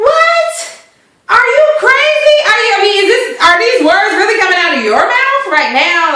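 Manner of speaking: high, loud incredulous yelps; viewer's stunned reaction to the wife; shocked disbelief. Retold or imitated. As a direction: react like she's lost her mind. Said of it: "I was really thoroughly confused".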